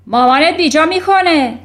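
loud, high angry snarl; roaring retort. A narrator's reading. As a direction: thunder back at the child. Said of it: "ترانچبول غرید".

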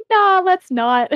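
high, drawn-out mock refusal; mock dismissal. Co-writer teasing the other over a rejected idea, mock dismissive. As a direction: imitate a partner brushing it off, slowly. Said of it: "but you were like".

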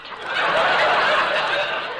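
studio audience laughing; big crowd laugh; crowd laughter. Real, not acted. Audience laughs at ox cart joke.

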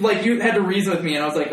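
ordinary talk

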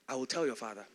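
low, soft warning; quiet threat. Retold or imitated. Servant kept telling the son, low and quietly menacing. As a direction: slip the threat in under the voice.

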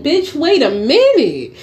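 high, laughing retort; cracking up while telling her off; incredulous laugh. Retold or imitated. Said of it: "I'm like".

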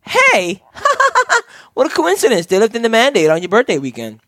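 high, bubbly imitation of the sister; lightly voiced excited sister; sister's excited voice. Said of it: "and she goes".

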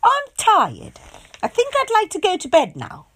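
high, loud plea; weary guest. A narrator's reading. Miss Wise asking her host for bed, weary. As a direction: beg off to bed.

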